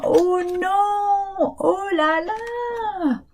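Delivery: mock dismay. Animals dismayed, child awake.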